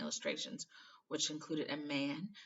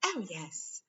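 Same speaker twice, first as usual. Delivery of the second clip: eager; 4 semitones higher than usual. Acted. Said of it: "said Hermione eagerly".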